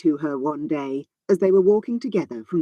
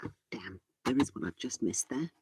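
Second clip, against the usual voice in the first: quiet muttering; 12 dB quieter than usual. Real, not acted. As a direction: mutter it under your breath. Quiet muttering while editing.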